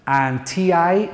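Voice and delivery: slow, naming the letters "T I" one at a time; spelling out letters. Shown as a demonstration. Teacher spelling out the last letters of ghoti. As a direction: spell "T I" out deliberately. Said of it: "nation, is sh".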